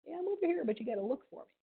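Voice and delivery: soft little reply, starting high; quiet female cicada. Acted. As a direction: answer softly as a demure lady cicada. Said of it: "just quietly sitting on their branches going".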